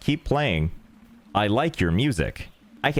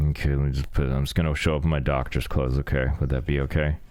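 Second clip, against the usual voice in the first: a hint of a tired doctor reading his own lines; lightly voiced tired doctor; low doctor voice; 9 semitones lower than usual.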